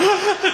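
sharp high gasp, hyperventilating; imitated panicked gasp. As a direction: suck in air in panic. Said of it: "Hamza is hyperventilating".

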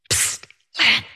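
whispered hiss of a name; stage whisper. Retold or imitated. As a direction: whisper to get her attention unseen. Husband hissing through curtain.

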